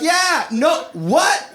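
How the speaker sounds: high, baffled yeah no what; confused stoner. Retold or imitated. Stoner in the crowd realizes late, high and baffled. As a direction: play the lost stoner catching up. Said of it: "You're like".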